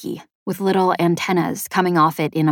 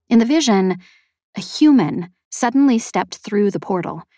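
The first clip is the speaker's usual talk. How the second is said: ordinary delivery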